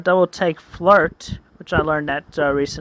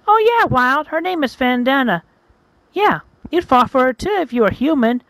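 higher, emphatic chatty phone voice; gossiping friend; 5 semitones higher than usual. Acted. Rosie on her cell phone to a friend, gossiping voice. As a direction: gush the gossip in a raised pitch. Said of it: "talking to Wild Sideshow on her tel".

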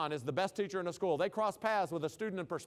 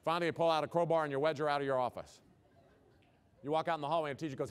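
normal talking voice

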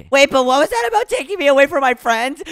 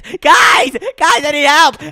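high, loud call to friends; yelling for help; 5 semitones higher, 7 dB louder, slower than usual. Acted. Patient yelling for his friends, urgent cry for help. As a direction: yell out urgently for rescue. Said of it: "I'm yelling for my friends".

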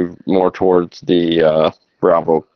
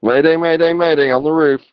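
high, urgent distress call; urgent mayday call; 8 semitones higher than usual. Acted. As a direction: cry for help over the radio. Firefighter trapped calling mayday.

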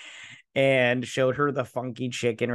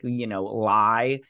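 ordinary delivery

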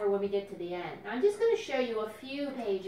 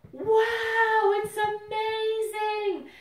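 high, loud sung-out wow; wow exclamation; 12 semitones higher, 9 dB louder, much slower than usual. Shown as a demonstration. Librarian showing kids what spectacular sounds like, high and loud. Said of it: "Spectacular means".

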